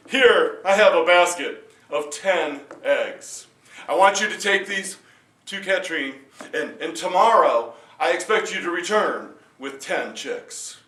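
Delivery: loud royal order; a king setting an impossible task; commanding king. Acted. King sets new task.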